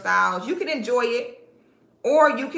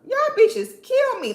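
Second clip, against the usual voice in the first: amused exasperation; 13 semitones higher, 6 dB louder, slower than usual. Real, not acted. Laughing at commenters.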